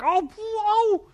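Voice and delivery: high, loud yelp of shock at icy water; startled yelp. Retold or imitated. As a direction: jolt up into a loud squeal. Stepping into freezing water.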